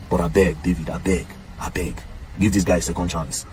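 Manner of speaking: low, soft appeal; earnest pleading. Real, not acted. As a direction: plead gently, voice dropped low and quiet. Pleading with [name] for another man.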